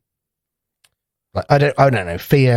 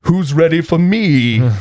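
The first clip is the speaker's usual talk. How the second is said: grand entrance; 8 semitones higher, 3 dB louder, slower than usual. Pretend. Narcissus making a grand entrance.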